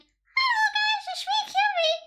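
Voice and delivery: hammed-up kawaii debut voice, breaking into laughs; fake cute VTuber voice. Shown as a demonstration. Streamer mocking a fake-voiced VTuber debut, in a squeaky cute voice. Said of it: "naturally I sound like".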